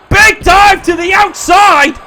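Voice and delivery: high, loud, drawn-out commentator calls; excited play-by-play. Acted. Commentator calling a near fall to viewers, loud and excited. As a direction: call the big moves like a hyped announcer.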